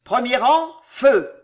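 strong officer's command; powerful and controlled. A narrator's reading. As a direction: command the volley with controlled force. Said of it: "Puissante et calme".

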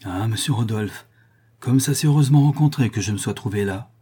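ordinary delivery

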